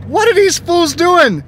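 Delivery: high, slow, incredulous spectator voice; baffled onlookers. Retold or imitated. Onlookers watching the match, high baffled voice. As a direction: play a stunned viewer asking what is going on. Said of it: "people watching were like".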